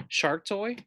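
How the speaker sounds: usual speaking voice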